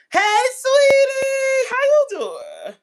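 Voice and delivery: high, slow sugary coworker voice; flirty greeting. Retold or imitated. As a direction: coo it like a flirt teasing a shy man.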